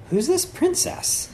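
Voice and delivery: very high, drawn-out question; slow, high-pitched mock wonder; smitten wondering. Prince seeing a painting of a princess, smitten wondering.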